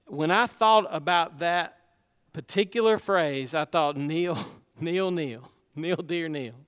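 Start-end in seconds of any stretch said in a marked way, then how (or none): 4.0-6.5 a soft, low, gentle call of the name; quiet, lower, tender repetition of "Neil"; tender rebuke. Retold or imitated. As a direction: call him back gently, like a loving father.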